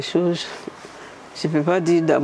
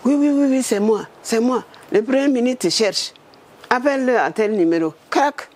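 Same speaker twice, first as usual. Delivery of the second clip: hurried caller; 7 semitones higher than usual. Retold or imitated. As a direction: rattle it off and hang up. Minister's hurried phone message.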